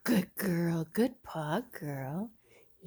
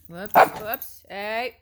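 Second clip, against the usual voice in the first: loud startled whoops; sudden loud whoops; 2 semitones higher, 11 dB louder, slower than usual. Real, not acted. Owner loudly reacting as the two dogs collide. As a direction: blurt it out as the dogs bump.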